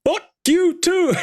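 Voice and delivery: high, slow, laughing falsetto comeback; imitating a cheery reply. Friend replaying the prince's cheerful comeback to a heckler. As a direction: toss the insult back sweetly and slowly. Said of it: "He goes".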